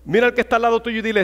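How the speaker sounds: usual speaking voice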